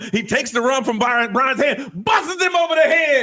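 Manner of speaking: high narration rising to a shout; excited storytelling peaking in a yell; building shout. Acted. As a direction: build to a loud, high climax.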